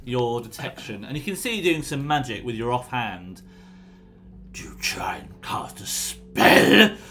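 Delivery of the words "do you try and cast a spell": menacing whisper. Acted. Prisoner catches a spell.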